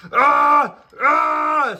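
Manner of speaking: high, loud scream of pain; high screams of a character in pain. Acted. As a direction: shriek out in agony. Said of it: "screamed Charlie, clearly in pain".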